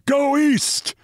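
high, forceful roaring cry; powerful roar. A narrator's reading. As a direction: roar it like a vengeful prophet. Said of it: "roaring ... in his powerful voice".

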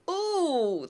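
high, drawn-out mock-squeamish "ooh"; high-pitched imitated discomfort; uneasy ooh. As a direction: imitate someone recoiling at the very idea. Voicing people uneasy about showing off.

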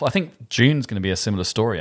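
ordinary talk, nothing marked

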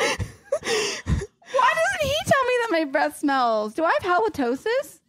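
high-pitched, incredulous protest; real dismay, voice shooting up high; dismayed outburst. Real, not acted. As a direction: blurt it out high, aghast at the news. Dismayed on learning her breath smells.

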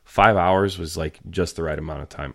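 normal talking voice

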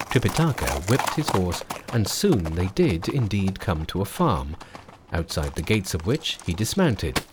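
usual speaking voice

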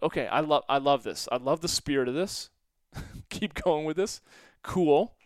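bright, eager encouragement; excited. Retold or imitated. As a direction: hype the kid up. Retold own eager reply to kid.

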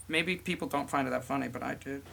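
sad. Real, not acted. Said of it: "that was the saddest I've ever heard you".

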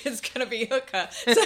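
giggles the punchline out; laughing. Real, not acted. Guest laughing at the host's rugby question. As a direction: laughs while owning the joke. Joking about her rugby position.

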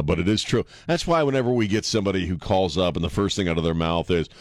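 ordinary delivery

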